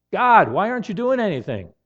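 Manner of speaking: rising frustration at God's silence; frustrated plea. Retold or imitated. Frustrated prayer after a week.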